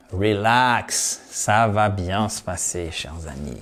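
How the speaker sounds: low, soft, soothing reply; calm reassurance. Acted. Answers the mock panic, soothing. (rassurant, voix basse et douce)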